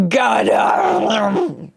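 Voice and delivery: sudden high cry of real annoyance; a strangled cry of real frustration; frustrated yelp. Real, not acted. Frustrated outburst at phone ringing.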